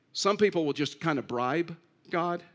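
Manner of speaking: ordinary delivery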